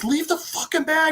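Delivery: high-pitched acted snap; exasperated order. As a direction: imitate a frantic sister ordering him. Sister yells back while fleeing.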